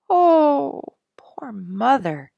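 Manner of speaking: high, slow lament; pitying cry. A narrator's reading. Daughter lamenting her sprayed mother, high and slow. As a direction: sigh it out for mother.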